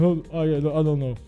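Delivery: ordinary delivery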